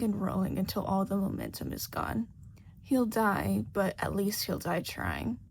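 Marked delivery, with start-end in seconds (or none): none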